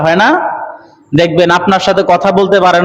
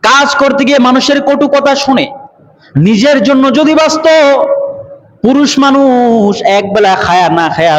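high-pitched sermon delivery; intoned Bengali sermon; impassioned preaching; 8 semitones higher than usual. Acted. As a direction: preach it out on a raised, ringing pitch.

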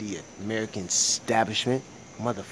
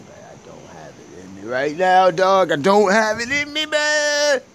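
tired outburst; 6 semitones higher, 6 dB louder than usual. Real, not acted. Venting to viewers about no energy left, rising to a loud cry. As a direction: voice climbing into a loud exhausted cry. Exhausted outburst after long day.

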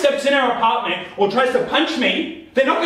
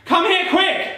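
high, pressing call; feigned emergency, pitched right up; urgent summons; 9 semitones higher, slower than usual. Pretend. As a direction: call them over in a staged panic. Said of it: "I'm gonna rush in and tell Kate".

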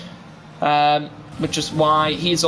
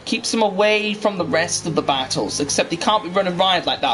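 ordinary talk

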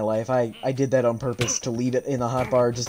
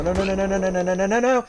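panicked no; 6 semitones higher, 3 dB louder, much faster than usual. Real, not acted. Panic as vexes spawn.

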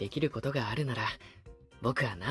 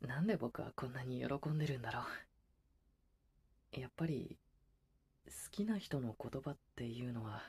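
quiet murmur; 6 semitones lower, 11 dB quieter, much faster than usual. Acted. Soft monologue alone at night.

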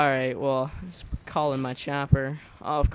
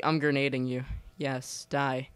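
ordinary talk

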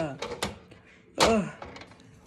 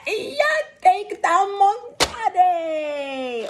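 higher, slow, loud move call; finisher call; 10 semitones higher, 4 dB louder, much slower than usual. Pretend. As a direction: belt out the finisher name.

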